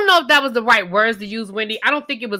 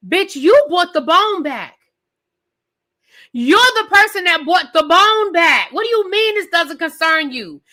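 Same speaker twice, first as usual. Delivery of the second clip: indignant disbelief; 5 semitones higher than usual. Real, not acted. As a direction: tell her off like she can't believe it. Ranting at a cast member.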